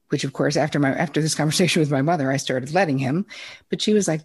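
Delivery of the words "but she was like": usual speaking voice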